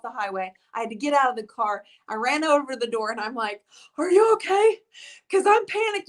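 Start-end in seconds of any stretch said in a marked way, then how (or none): 4.0-6.1 higher imitating a panicked check-in; mock panic. Retelling running to her friend's car, mock panic. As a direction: replay the frantic "are you okay" at the car door.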